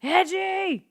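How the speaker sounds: high shouted call of a nickname. Retold or imitated. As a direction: call out a teammate's name. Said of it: "audio of Bruce Bochy saying".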